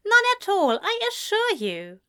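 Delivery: high, bright polite reassurance; beaming. A narrator's reading. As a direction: reassure them with a beaming smile. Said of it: "Ezra beamed".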